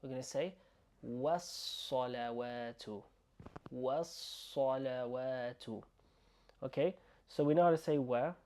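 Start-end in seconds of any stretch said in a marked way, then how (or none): 1.0-5.8 was-salawatu as one word, S held; merged was-salawatu. Shown as a demonstration. Teacher showing learners two words merged, slow was-salawatu. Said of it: "merge these two words together".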